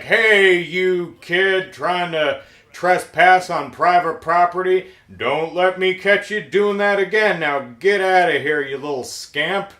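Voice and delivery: hammed-up cop telling-off; scolding cop. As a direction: chew out a trespassing kid, dragging out the opening words. Retold cop scolds trespasser.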